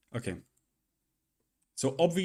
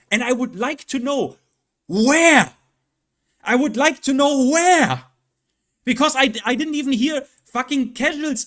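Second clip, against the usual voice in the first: higher and louder, building to a shout; rising rant; 9 semitones higher, 6 dB louder than usual. Real, not acted. Streamer demanding where the feedback came from, building shout. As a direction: call out demanding an answer. Ranting at absent fans.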